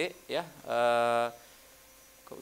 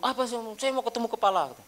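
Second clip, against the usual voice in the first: angry customer; 10 semitones higher than usual. Retold or imitated. Angry customer demands manager.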